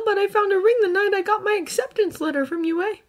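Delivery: usual speaking voice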